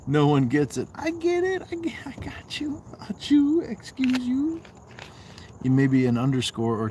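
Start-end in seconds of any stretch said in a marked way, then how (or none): 0.9-4.6 very high falsetto, half of it whispered; silly high-pitched voice dropping into a whisper. Pretend. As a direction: pipe up way high, then whisper the middle. Playful put-on squeaky voice.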